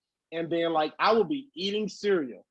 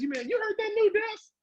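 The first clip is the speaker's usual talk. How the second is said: much higher, excited fan voice asking about a new song; hyped fan. Retold or imitated. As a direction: play a fan gushing over a track.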